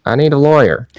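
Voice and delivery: high-pitched, half-hearted shout; mock shout. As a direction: pretend to call out for a lawyer. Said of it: "I'm gonna shout".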